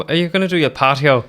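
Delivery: put-on gardener with a broad Irish accent, patio with an Irish lilt; cheerful, fast Irish gardener. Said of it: "say it in an Irish accent".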